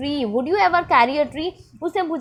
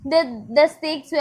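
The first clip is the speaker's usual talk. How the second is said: ordinary delivery, nothing marked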